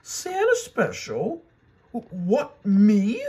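stunned, hardly believing it; a high, loud, stammering disbelief; surprised. A narrator's reading. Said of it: "Daisy was surprised".

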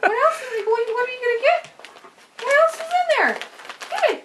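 صوت مرتفع جداً كأنه يكلم طفلاً؛ يضحك ويرفع صوته متحمساً للكلب؛ يحمس كلبه ليفتح الجوارب بصوت عالٍ ضاحك; high pet voice. Real, not acted. Baby-talk to dog opening stocking.

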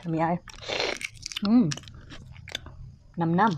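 talking while eating egg; savoring food between words; mouth full, chewing. Real, not acted. Seen 0: Left speaker sips egg at mouth, then chews, licks lips while speaking.

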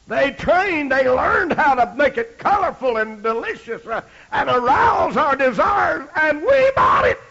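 high, loud fiery preaching; fire-and-brimstone sermon climax; preaching at full force. Acted. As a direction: build it up to a rousing shout.

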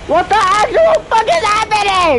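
high, loud stunned outburst; can't believe what he's seeing; shocked yell. Real, not acted. Gamer shouting at the strange game, high and loud disbelief.

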